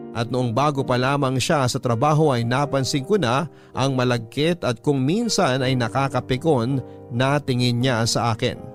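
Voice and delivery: ordinary talk, nothing marked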